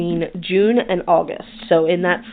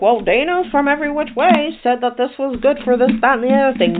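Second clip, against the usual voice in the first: higher-pitched parroting voice; singsong, raised-pitch mock quote; mock naive listener; 7 semitones higher, faster than usual. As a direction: imitate someone naively citing what they heard. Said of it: "don't just be like".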